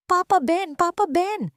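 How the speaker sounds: put-on a little boy running to his new dad, joyful; happy child shouting. A narrator's reading. Leo runs to Ben and Tessa, joyfully shouting. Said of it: "masayang sumisigaw ng".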